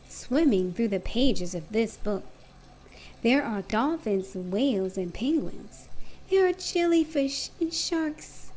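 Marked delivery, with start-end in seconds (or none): none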